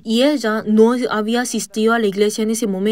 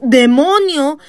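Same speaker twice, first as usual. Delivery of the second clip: high, loud, sharp cry of rebuke; shout; 6 semitones higher, 5 dB louder, slower than usual. Retold or imitated. Son rebuking the demon inside his mother, shouting. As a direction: hurl the word at the demon. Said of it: "Y cuando grité".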